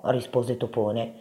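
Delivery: ordinary delivery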